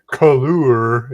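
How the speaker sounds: color drawn out as "cuh-loo-er"; color with extra vowels, teasingly British; mock British pronunciation. Shown as a demonstration. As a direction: say color slowly, with an exaggerated "oo-er" ending. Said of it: "if you're British".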